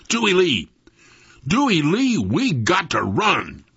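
loud, high call dropping on the last words; yell. A narrator's reading. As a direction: yell urgently to snap him out of it. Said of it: "yell right in his ear".

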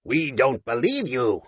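slow even robot voice; measured, deliberate robot. Acted. Robot Scallywag speaking.

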